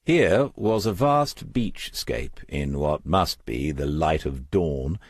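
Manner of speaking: usual speaking voice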